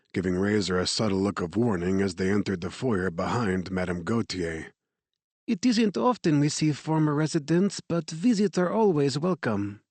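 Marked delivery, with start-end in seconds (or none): 5.5-9.8 put-on light French accent, higher woman's voice; a gracious French director's greeting. A narrator's reading. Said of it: "her English marked with a French accent".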